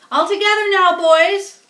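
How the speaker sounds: rally the crowd of helpers; calling out. Acted. Zuckerman calls the helpers.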